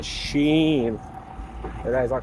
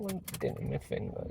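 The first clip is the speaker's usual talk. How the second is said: whisper; 5 semitones higher, 15 dB quieter than usual. Real, not acted. Complaining about sore finger.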